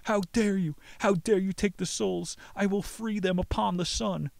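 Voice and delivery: hammed-up angry demon; lightly voiced raging devil. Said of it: "began to yell in anger at the young man with a deep voice".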